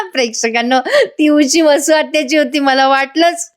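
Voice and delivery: chuckling through the words to viewers; can't hold back a real laugh. Real, not acted. Laughing to viewers about the aunt's pillow.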